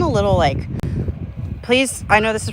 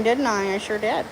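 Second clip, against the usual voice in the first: ordinary talk, nothing marked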